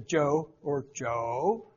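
JOE drawn out low, ending in a rise; slow, low Joe tones for contrast; Chinese tone demo. Shown as a demonstration. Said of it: "way you pronounce Chinese words".

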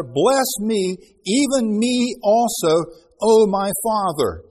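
loud, slow, pleading cry to his father; bitter cry. Acted. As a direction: beg loudly and slowly, as if crying out. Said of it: "He cried with a great and exceeding bitter cry".

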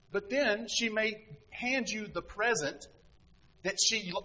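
normal talking voice

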